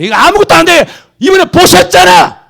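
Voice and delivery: high, loud, shouting sermon; fiery preaching. Real, not acted. Preacher scolding megachurches at full force, shouting. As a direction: thunder at the flock, slow and hammering.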